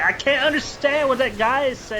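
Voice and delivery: high-pitched kid whine, put on; American schoolboy. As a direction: imitate a baffled American fifth-grader. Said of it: "ten-year-old fifth grade boys from the United States".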